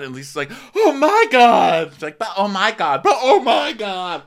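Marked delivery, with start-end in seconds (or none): 0.8-1.9 louder, higher, drawn-out mock wail; hammed-up competitive sobbing; fake crying. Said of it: "you're both fake crying". 2.2-4.2 loud high dueling mock wails; fake crying. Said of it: "you're both fake crying".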